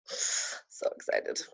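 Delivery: slow breathy whisper of excitement. Real, not acted. Repeat of line 34, whispered.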